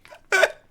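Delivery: shrieking high burst of laughter; real yelping laugh; strange laugh. Real, not acted. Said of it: "that was a very strange laugh".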